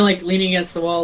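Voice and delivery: normal talking voice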